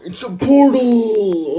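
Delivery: high, loud amazed shout; excited shout. Retold or imitated. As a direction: yell it in amazement. Discovering a portal in story.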